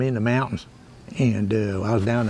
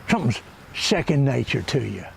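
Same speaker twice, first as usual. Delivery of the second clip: ordinary talk, nothing marked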